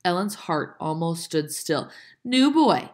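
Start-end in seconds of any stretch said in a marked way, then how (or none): 2.2-2.9 loud, high hail; calling out. A narrator's reading. Dicey hails a new boy, calling out. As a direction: call across to a stranger. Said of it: "Dicey called".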